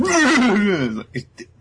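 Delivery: shrill cackle in fairy voice; high squeaky laugh. Retold or imitated. Sylph laughing at Asta's bath story, high cackle.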